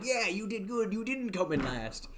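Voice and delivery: mock enthused. Shown as a demonstration. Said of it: "I'm trying to sound still, like, enthused".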